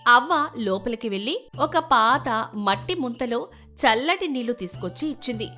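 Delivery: ordinary delivery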